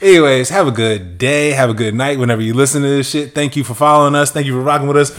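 ordinary talk, nothing marked

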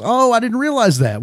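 a touch of surprised acceptance; mild surprise. Acted. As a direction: play a boy who just learned something. Altar boy learns the word is innocent.

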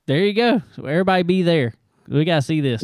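high, loud hype; excited. Real, not acted. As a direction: pump up the audience. Hyping the listeners up.

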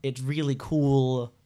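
slow, stretched "it's really cool"; drawn-out put-on voice. Reviewer says it's really cool in a slow silly voice. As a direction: drag it out in a silly voice. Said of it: "why I said it in that voice".

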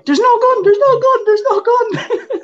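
high, loud mock panicked yell; screaming at guards. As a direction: scream it desperately at running guards. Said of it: "I'm screaming at security guards".

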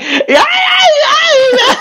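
high, wailing crybaby imitation; exaggerated bawling yell, mocking; mock whining cries.

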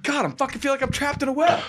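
high despairing whine; mock despair. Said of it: "he's like".

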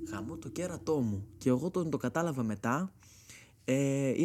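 ordinary talk

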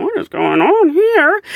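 high, slow, baffled what-is-this; slow mock bewilderment; puzzled onlookers. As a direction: voice the bystanders' confusion. Onlookers wondering at the pool.

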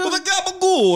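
exaggerated fake Italian; mock Italian accent. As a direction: ham up the gabagool like a mob cartoon. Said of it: "not a Japanese accent".

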